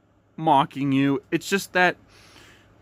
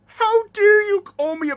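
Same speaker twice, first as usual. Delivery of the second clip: outraged gasp; 11 semitones higher than usual. Acted. Insulted character reacts.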